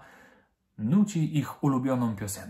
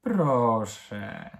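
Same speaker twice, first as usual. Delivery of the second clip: drawn-out, coaxing please; sweet pleading; 3 semitones lower than usual. A narrator's reading. Girl begging mum to let her help, sweetly. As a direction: plead sweetly, stretching the word. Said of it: "powiedziała słodko Madzia".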